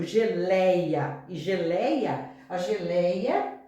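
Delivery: geleia said very slowly, each syllable drawn out; slow demonstration. Shown as a demonstration. Teacher slowly sounds out geleia as an example. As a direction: spell geleia out by ear for the class. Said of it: "por exemplo".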